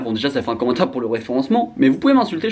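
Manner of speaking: ordinary delivery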